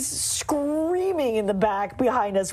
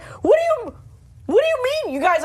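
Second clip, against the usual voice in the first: high, louder bewildered "what do you mean"; alarmed question; 12 semitones higher, 4 dB louder than usual. Retold or imitated. Friend imitating the wife confused on the ride, high and alarmed. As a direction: voice her rising alarm. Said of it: "And she's like".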